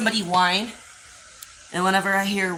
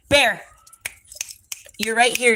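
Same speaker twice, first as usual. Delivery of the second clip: louder calls, pitch up; raised calling voice; calling the dog; 4 semitones higher, 6 dB louder than usual. Real, not acted. Calling deaf blind dog.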